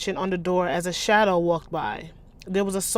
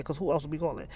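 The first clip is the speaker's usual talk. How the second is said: plain reading voice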